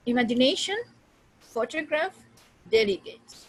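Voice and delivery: Imagination, photograph, delegate with stressed syllables punched; teacher's careful stress on Imagination, photograph, delegate; demonstrating word stress. Shown as a demonstration. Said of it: "that is primary stress".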